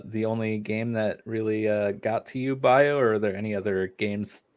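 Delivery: ordinary talk, nothing marked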